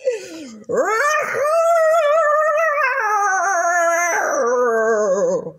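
a long high laugh sliding down; amused laugh. Real, not acted. The reader laughs at her own story, amused laugh. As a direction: let a real laugh roll out and fade.

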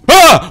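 high, loud yelp; short sudden cry of fright; startled scream. Real, not acted. Player attacked by a monster, startled scream.

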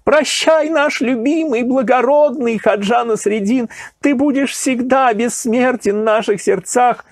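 high calls of farewell after him; farewell cries. A narrator's reading. Weeping crowd calling farewell to Nasreddin, high cries. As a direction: call after him, grieving and loving. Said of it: "кричали ему вслед".

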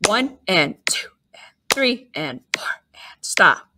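whispered rest counts; counting under breath. Shown as a demonstration. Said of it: "using our whisper count".

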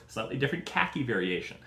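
normal talking voice

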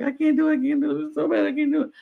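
high-pitched imitation of self-doubt; mock helpless complaint. Mimics people doubting themselves.

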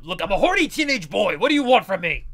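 loud put-on teenage boy voice. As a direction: belt it out as a brash teen boy. Teen boy character voiced loudly.

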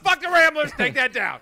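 mock shout. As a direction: shout it like a fake outburst. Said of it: "it's not".

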